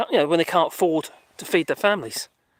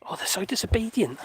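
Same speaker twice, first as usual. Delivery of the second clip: whisper; 6 dB quieter than usual. Real, not acted. Mock scolding the dogs softly.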